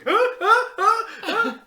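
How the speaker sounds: high, loud horrified gasps; panicked gasps. Retold or imitated. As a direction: gasp in horror like waking from a nightmare. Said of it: "you just wake up as going".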